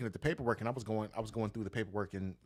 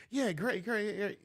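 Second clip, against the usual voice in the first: high, sarcastic "great, great"; fake enthusiasm; 11 semitones higher, slower than usual. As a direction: pretend to be impressed, voice pitched way up. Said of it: "And I'm like".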